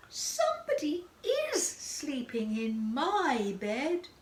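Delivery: high squeak, then slow and drawn out; squeaky baby bear. A narrator's reading. Little Bear finding someone in his bed, squeaky voice. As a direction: start in a high squeal, stretch out the rest. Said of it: "squeaky baby voice".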